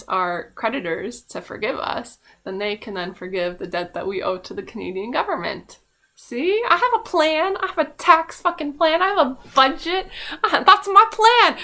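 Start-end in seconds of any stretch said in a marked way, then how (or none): 6.2-11.6 loud, high-pitched burst of insistence; mock triumphant. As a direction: announce your grand plan at full volume, pitched way up. Mock pitch of her budget plan.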